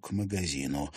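usual speaking voice

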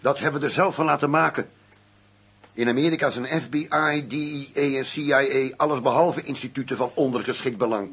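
loud indignant comeback; heated argument. Acted. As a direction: hammer the point home angrily. Agent argues with his boss.

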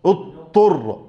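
اضطر said as اطّر with no ض; imitating the assimilation error; dad merged into taa. Shown as a demonstration. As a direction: swallow the ض of اضطر into the ط. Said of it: "يدغم الضاد في الطاء".

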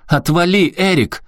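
громкий визгливый ор, намного выше; наори изо всех сил; заорала. Acted. Повтор крика Аляски. (Аляска орёт на Эрика у машины)

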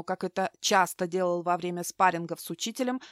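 plain reading voice

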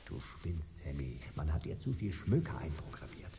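hushed annoyed whisper; soft whispered rebuke. Acted. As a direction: keep it down in the dark passage. Whispering in secret passage.

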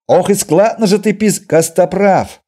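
high, loud taunt into a sharp reprimand; loud jeering outburst. Acted. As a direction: raise the voice hard, cut across him. Insulting remark cut off, name barked. (громкий выкрик с наездом)

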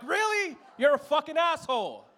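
high, loud indignant shout; mock furious shout; outraged yell. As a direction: yell after the runner. Yelling at fleeing robber.

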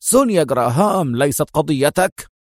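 ordinary delivery, nothing marked

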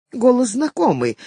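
plain reading voice

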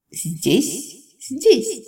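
wild shrieking beasts, laughing; frenzied creature yelling. A narrator's reading. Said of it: "истошно вопили на все голоса".

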